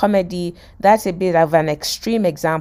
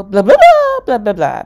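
gibberish; 3 semitones higher, 9 dB louder than usual. Acted. [name] putting the phrase together, loud gibberish. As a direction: blurt nonsense as if it were French. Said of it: "basically gibberish".

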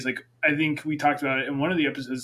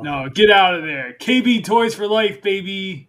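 excited shout; 4 semitones higher, 7 dB louder, slower than usual. Real, not acted. Defending childhood toy store loudly.

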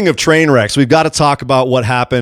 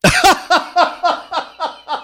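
high laugh; 4 dB quieter than usual. Real, not acted. Reaction to secret.

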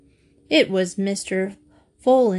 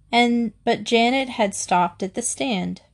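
ordinary delivery

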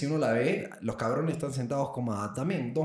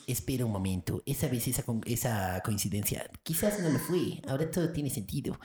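hushed mock inner monologue; voz interior. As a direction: think aloud in a put-on voice of dawning realisation. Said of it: "Nosotros hablamos así". (monólogo interior fingido, como hablando consigo mismo)

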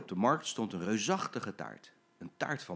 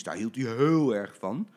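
ordinary delivery

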